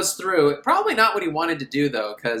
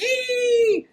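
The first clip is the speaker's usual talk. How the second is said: tiny high creature shriek; shrill monster cry; gremlin squeal. Retold or imitated.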